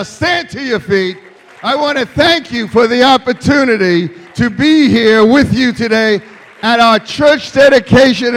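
very loud, high preaching shout; climactic preaching. Real, not acted. Preacher bringing congregation to their feet, loud high shout. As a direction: proclaim it at full force to a standing church.

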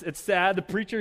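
normal talking voice

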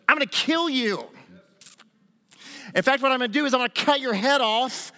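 prophesying boast. Retold or imitated. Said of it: "He begins to prophesy".

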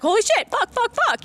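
high, excited burst of swearing; passionate outburst. Retold or imitated. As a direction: re-enact getting carried away, pitch jumping up. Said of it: "sometimes I just get passionate".

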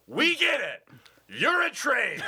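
high, loud, jokey put-down; mock train heckle. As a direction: yell it at the passing train. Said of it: "and he just goes".

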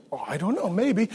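low, very soft noncommittal reply; coy mock hesitation. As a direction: drop it low and soft, feigning modesty. Imagined coy answer to flattering question.

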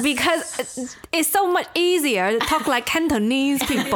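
lightly put-on Cantonese accent. Shown as a demonstration. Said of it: "my Chinese accent has become more Cantonese".

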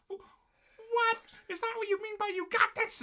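very high, loud shocked squeal; shocked girl. Retold or imitated. Olivia exploding at Hanako, very high shocked squeal. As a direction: shriek it in disbelief like an anime girl. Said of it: "Olivia couldn't hold it any longer".

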